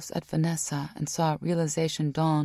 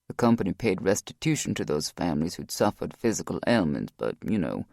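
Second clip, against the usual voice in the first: lower, steady male voice; Rock's voice; 7 semitones lower than usual. A narrator's reading. Rock explains.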